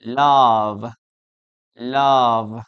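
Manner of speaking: LOVE with a drawn-out voiced V; low, slow LOVE, buzzing V at the end; correct pronunciation. Shown as a demonstration. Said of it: "the correct way to pronounce this is".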